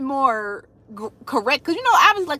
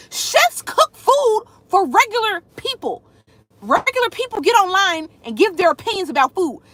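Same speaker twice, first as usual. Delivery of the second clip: exasperated rant; 6 semitones higher than usual. Acted. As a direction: argue it like it's obvious. Arguing back at a critic.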